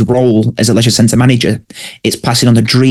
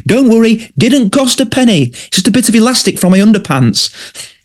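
Colin impression; 10 semitones higher than usual. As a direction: play cheery Colin, pitched way up. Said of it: "he goes".

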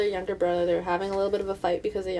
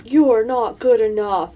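slow, loud wizard pronouncement; wizard's verdict; 2 semitones higher, 6 dB louder, slower than usual. Acted. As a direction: pass judgment as a stern old wizard. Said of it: "Then the wizard's like".

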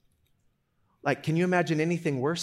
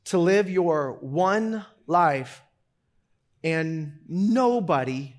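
solemn slow delivery; much slower than usual. Real, not acted. Slow solemn sermon point.

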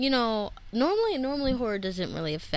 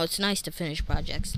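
ordinary talk

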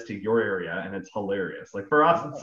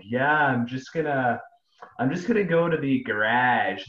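mock East Coast Canadian accent; Nova Scotia accent; 3 semitones higher, 3 dB louder than usual. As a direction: imitate a Nova Scotian trying to sound normal. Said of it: "somebody that moved from, like, Nova Scotia".

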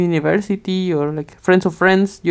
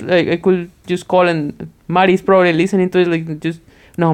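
ordinary delivery

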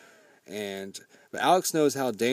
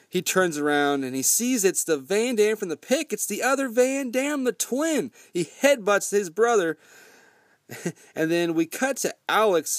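ordinary talk, nothing marked

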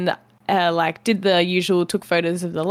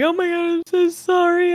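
high-pitched imitated wail; mock crying apology; 10 semitones higher than usual. Retelling apologies to the other driver, mock crying. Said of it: "I was crying".

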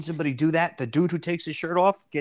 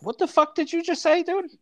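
"What the fuck did you just say" thrown up high in disbelief; incredulous outburst. Retold or imitated. Retelling his reaction to the stranger, in high mock disbelief. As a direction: play the stunned local who can't believe his ears. Mocking reply to a mispronunciation.

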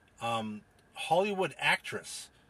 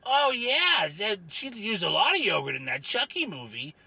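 gruff-tempered Rufus character; 7 semitones higher than usual. Acted. Rufus names his favorite actress to host, cranky character voice. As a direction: stretch word endings as an opinionated oddball.

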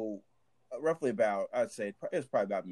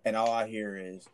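ordinary talk, nothing marked

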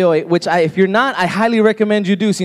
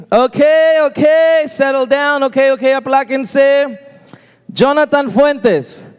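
raised, higher voice over a class; teacher calling roll; 5 semitones higher, slower than usual. Acted. Teacher to students after the bell, calling roll. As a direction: call the class to order, then the name. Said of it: "they start by saying".

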